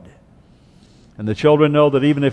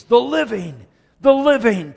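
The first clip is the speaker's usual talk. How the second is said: THE LIVING said higher, repeated after a pause; emphatic repetition; 7 semitones higher than usual. Shown as a demonstration. Preacher showing why Hezekiah repeats his words, emphatic. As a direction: lift THE LIVING emphatically both times, pausing between. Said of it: "to emphasize that this surely will happen".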